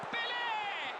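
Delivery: high, bright shout of the name; excited name call. Acted. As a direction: call the player out. Player name called out.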